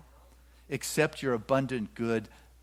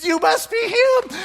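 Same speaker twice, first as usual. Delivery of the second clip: a much higher, louder, drawn-out maiden's cry; excited maiden; 8 dB louder, slower than usual. Acted. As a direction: shout it like the maiden who has found her man. Maiden voiced in the parable.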